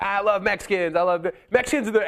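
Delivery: high-pitched stand-up delivery; mimicking an oblivious comedian on stage; imitated hack comic. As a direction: launch into a hammy crowd-work bit. Imitates a comic starting his bit.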